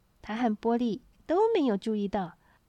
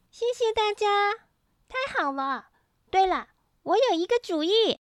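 put-on higher-pitched happy child voice; delighted child character; overjoyed; 9 semitones higher than usual. A narrator's reading. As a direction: burst out gladly with rising excitement. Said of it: "波利实在太高兴了".

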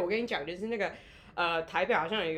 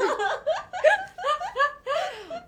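high shrieking laugh, louder; burst of laughter; 5 dB louder than usual. Real, not acted. Guest bursts out laughing before telling her app story.

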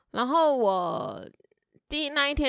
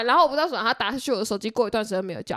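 ordinary talk

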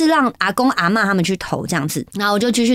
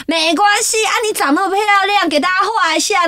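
placating granny; 8 semitones higher than usual. Retold or imitated. Granny brushes off her tears.

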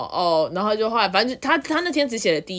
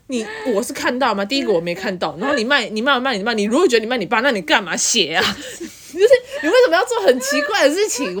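exasperated disbelief; 4 semitones higher, 5 dB louder than usual. Retold or imitated. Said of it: "我真的完全不懂".

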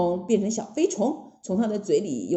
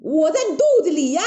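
loud, high, quick call; cheeky, high shouted reveal; gleeful Monkey King shout; 7 semitones higher, 5 dB louder, much faster than usual. Acted. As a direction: holler teasingly as the Monkey King. Wukong shouts from the belly.

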